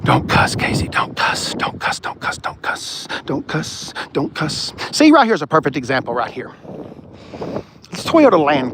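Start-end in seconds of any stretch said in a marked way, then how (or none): 0.0-3.1 unvoiced, under-the-breath reminder, fading away; whispered to himself. Real, not acted. A streamer reminding himself not to swear, whispered. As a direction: hiss it to yourself so the viewers barely hear.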